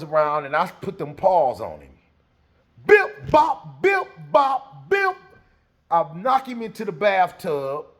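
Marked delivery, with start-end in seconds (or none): 2.9-5.2 high, loud bip bop punches; comic punching noises, loud and high; punch sound effects. Retold or imitated. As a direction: pop out each punch sound.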